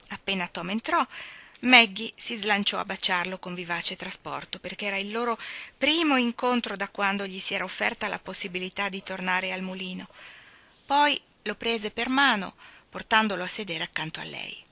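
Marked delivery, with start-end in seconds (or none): none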